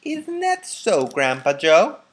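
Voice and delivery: put-on an old woman seeking agreement; high elderly woman's voice; Grandma Josephine. A narrator's reading.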